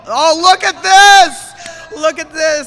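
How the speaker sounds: loud excited exclamation; excited shout. Real, not acted. Excited play-by-play moment.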